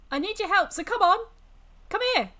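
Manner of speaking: high, loud, insistent call; impatient summoning; calling for help. Acted. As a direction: urge him over at the top of your pitch. Demon calls the man over.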